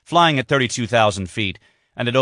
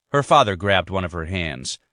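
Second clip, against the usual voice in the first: ordinary delivery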